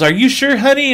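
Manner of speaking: high, sugary mock hostess voice; condescending hostess. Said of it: "She goes".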